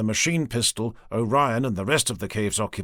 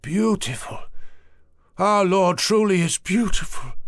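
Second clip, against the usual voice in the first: trance-like rapture; 7 semitones higher, 3 dB louder than usual. Acted. Priest in trance.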